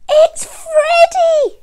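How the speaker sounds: very high, loud, drawn-out cry of recognition; excited child's cry. A narrator's reading. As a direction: call it out high and delighted. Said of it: "said Eddie".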